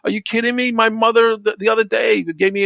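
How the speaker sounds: high and loud, incredulous; loud, high-pitched are-you-kidding-me outrage; indignant rant. Real, not acted. Ranting about behind-back talk.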